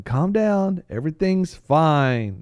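high, slow, drawn-out everything's fine; hollow reassurance stretched out; mock reassuring banker. Bank officials soothing depositors before the crash, mock calm.

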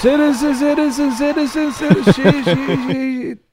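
high, loud echoing chant; hyped announcer intro. Acted. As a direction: shout it like a ring announcer. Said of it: "I had to do my own then".

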